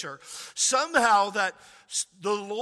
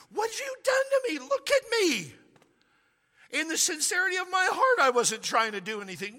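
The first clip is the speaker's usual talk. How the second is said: outraged accusation; 6 semitones higher than usual. Retold or imitated. Pharaoh confronting Abraham over the lie, high outraged cry. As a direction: hurl it at him, wounded and furious.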